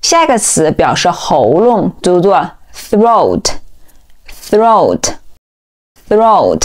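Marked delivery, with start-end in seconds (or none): 2.7-6.6 "throat" slowly, starting with tongue-between-teeth th; model th onset. Shown as a demonstration. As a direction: draw out "throat" from a voiceless th. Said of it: "第一个音同样也是咬舌音". (慢读throat，开头咬舌发th)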